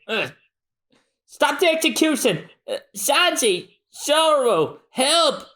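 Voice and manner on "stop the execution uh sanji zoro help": much higher, urgent calls; calling for help. Acted. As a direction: cry out to friends across the square. Luffy calls for his crew.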